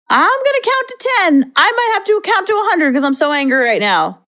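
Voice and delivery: silly mock anger. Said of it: "Silly, light, fluffy".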